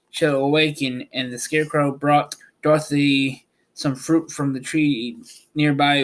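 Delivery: usual speaking voice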